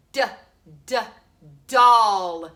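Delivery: D, D, doll with doll loud; sounding out D. Shown as a demonstration. Teacher to pupils, loud sounding out doll. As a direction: punch the doll after tapping D. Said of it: "What about".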